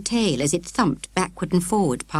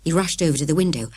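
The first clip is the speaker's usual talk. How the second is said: ordinary delivery, nothing marked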